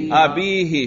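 "ابیہ" with a long ee, abīhi; jarr ending pronounced. Shown as a demonstration. Teacher drilling the jarr form with students, ending pronounced. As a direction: sound out "ابیہ" as a-bee-hee. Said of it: "اس کے جر پہ تلفظ کرو".

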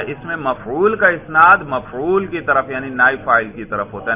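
ordinary delivery

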